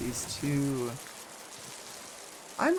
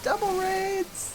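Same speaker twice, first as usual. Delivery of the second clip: excited; slower than usual. Real, not acted. Repeated, stretched higher.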